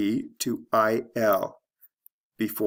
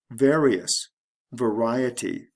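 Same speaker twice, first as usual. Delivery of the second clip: VARious, then vaRIety; shifting stress; slower than usual. Shown as a demonstration. Teacher showing a pair, stress moving to second syllable in variety. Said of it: "The stress shifts from the first syllable".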